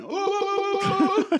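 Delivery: high falsetto ooh-ooh calls; Kramer's ooh. Retold or imitated. Kramer teasing from a cab window, high falsetto oohs. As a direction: sing out high ooh sounds like Kramer rocking the statue.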